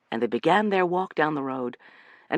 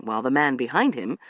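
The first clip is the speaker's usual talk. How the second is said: ordinary delivery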